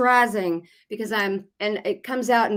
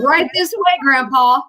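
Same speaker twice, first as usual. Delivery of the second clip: teasing salesman; 5 semitones higher, 8 dB louder than usual. Pretend.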